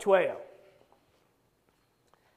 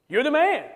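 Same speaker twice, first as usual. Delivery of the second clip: higher, louder pointed accusation; accusing; 6 semitones higher, 4 dB louder than usual. Acted. Prophet confronting King David, loud accusing declaration. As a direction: point the finger with every word. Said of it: "Nathan said to David".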